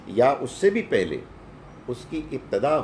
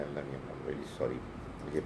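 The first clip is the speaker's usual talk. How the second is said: quiet plea; 6 semitones lower, 8 dB quieter than usual. Retold or imitated. Prayer asking Allah for help.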